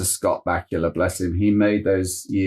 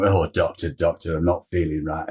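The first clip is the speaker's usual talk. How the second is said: put-on northern English accent; 6 semitones lower, 3 dB quieter than usual. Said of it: "with a thick northern accent".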